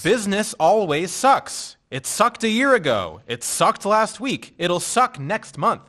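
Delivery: exasperated, loud pushback. Acted. Arguing with upset coworker.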